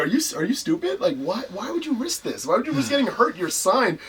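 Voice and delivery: high-pitched exasperated girlfriend imitation; incredulous scolding. Girlfriend scolding him for risking injury once signed, incredulous.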